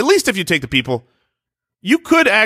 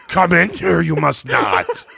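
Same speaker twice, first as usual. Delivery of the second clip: Yoda voice; slower than usual. Pretend. Yoda in bathroom stall.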